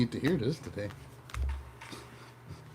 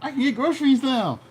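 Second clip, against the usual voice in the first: high excited bragging voice, much higher than her own; bragging show-off; 10 semitones higher than usual. Pretend. Mocking a friend who brags about her new job, high and giddy.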